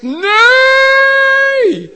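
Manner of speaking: long high held yell; hammed-up, drawn-out scream of protest; screamed refusal. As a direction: belt it out at the top of the voice, holding the vowel. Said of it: "mijn lichaam schreeuwt. Mijn vlees zegt".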